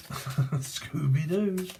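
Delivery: slow, drawn-out cartoon dog voice; stretched-out dog-voiced catchphrase; Scooby Doo. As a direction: howl the name out like a goofy cartoon dog.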